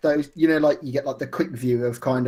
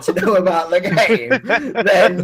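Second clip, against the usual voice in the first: laughing; 5 semitones higher, 4 dB louder, slower than usual. Real, not acted. Joke about David Lynch tag.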